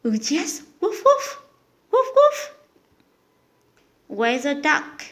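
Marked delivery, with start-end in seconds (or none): 0.8-2.6 put-on high playful woof for a child; dog bark imitation. Shown as a demonstration. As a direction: bark like a puppy for a toddler. Parent imitating dog for toddler.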